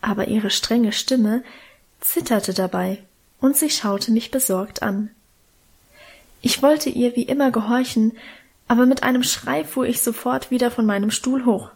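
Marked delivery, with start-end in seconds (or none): none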